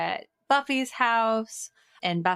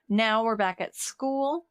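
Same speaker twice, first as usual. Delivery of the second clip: normal talking voice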